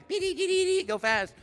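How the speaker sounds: very high, loud urgent beeping; frantic antennas. Retold or imitated. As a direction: imitate tiny antennas sounding an alarm. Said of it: "my little, you know, antennas, like".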